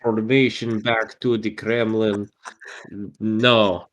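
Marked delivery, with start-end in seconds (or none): 0.0-2.3 put-on Russian accent. Jokingly plays a Russian spy.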